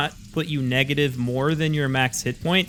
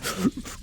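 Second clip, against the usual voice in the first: sharp gasp; 7 semitones higher, 6 dB quieter than usual. Acted. Goblin sees wolf die.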